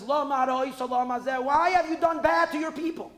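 anguished outcry. Acted. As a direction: cry out to God in pain for your people. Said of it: "That was Moshe's cry, outcry".

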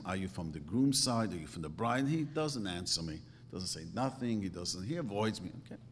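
ordinary talk

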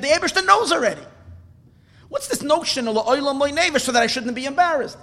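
high-pitched, fervent sermon delivery; impassioned preaching. Acted. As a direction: preach it high and urgent, pleading the point.

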